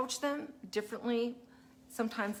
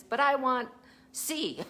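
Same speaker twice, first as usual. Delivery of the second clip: lightly voiced kid demand, higher and louder at first; mock a child holding out for more; insistent child; 6 semitones higher, 5 dB louder than usual. Child wanting another choice, mildly mock-insistent.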